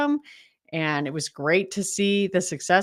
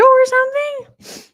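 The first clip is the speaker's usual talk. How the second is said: shocked; 8 dB louder, slower than usual. Real, not acted. Reacting to storm damage.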